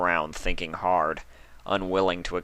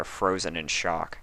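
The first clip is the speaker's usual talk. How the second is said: usual speaking voice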